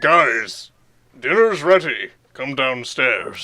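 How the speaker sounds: slow, low dinner call; calling kids to dinner. Pretend. Copies parent dinner call.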